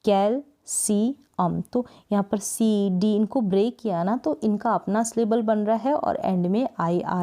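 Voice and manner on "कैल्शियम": "कैल्शियम" very slowly as कैल, सी, अम; broken into syllables. Shown as a demonstration. Teacher splitting the word for students, "कैल्शियम" syllable by syllable. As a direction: pause between the syllables of "कैल्शियम". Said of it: "जब इसको break करेंगे".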